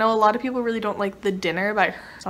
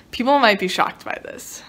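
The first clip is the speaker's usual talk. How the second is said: ordinary delivery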